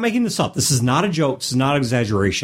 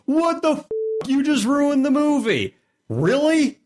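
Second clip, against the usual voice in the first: high, shrieking fake fury; mock outrage; 13 semitones higher than usual. Acting out outraged fan.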